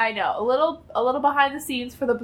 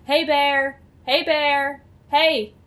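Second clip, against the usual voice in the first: calling out to a bear; firm call to shoo a bear; scaring off a bear; 5 semitones higher, much slower than usual. Retold or imitated. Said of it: "They would all just go".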